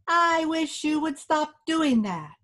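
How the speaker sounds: a hint of a tiny fairy man; little voice. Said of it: "a little voice".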